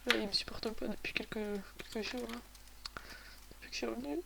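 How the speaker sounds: aparté sans voix; glissé tout bas; chuchoté. Real, not acted. Aparté sur l'ordinateur. (chuchotement agacé)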